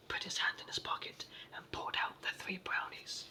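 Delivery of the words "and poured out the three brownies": plain reading voice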